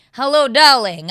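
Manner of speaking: loud, hammed-up Russian accent greeting; booming mock Russian accent. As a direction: play the regular customer in a hammy Russian accent. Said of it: "really strong Russian accent that I put on".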